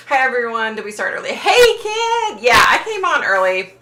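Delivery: excited greeting. Real, not acted. Streamer greeting a friend who just joined, excited. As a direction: call out hi happily.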